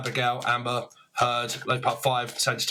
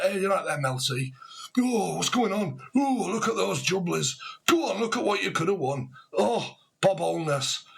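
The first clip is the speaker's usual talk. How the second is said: excited northern patter; Northern English accent; 5 semitones higher than usual. Retold or imitated. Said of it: "someone could be from up north and talk a bit like that".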